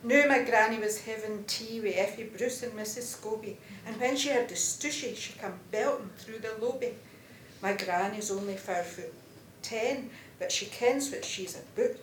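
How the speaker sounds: lively Scots verse read aloud to a class; bouncy storytelling recitation; poem recitation. Acted. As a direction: perform the ballad with relish, chuckling at the funny bits.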